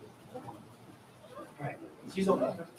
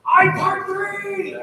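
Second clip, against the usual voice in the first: high, loud, drawn-out call; slow, high projected announcement; 11 semitones higher, 7 dB louder, much slower than usual. Shown as a demonstration. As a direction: sing out proudly, stretched long. Calling out to sections of choir.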